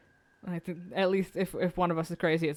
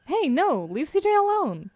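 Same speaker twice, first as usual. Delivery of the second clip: high protective cry; shouting; 8 semitones higher than usual. Acted. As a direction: yell to protect a friend. Defending friend from attackers.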